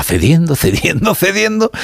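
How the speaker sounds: high rising sing-song repeat; mocking repetition. Radio host retelling the negotiator's account of concessions, mocking repetition.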